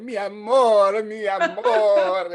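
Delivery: high, loud, slow romantic Italian put-on; mock Italian melodrama. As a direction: swoon it like an Italian movie lover, laughing. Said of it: "speaking, like, Italian or French".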